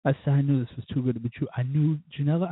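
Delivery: usual speaking voice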